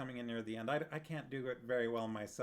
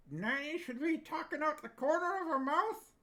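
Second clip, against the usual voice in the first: Nanny voice; 6 dB louder than usual. Pretend. Reading chat, trying Nanny voice.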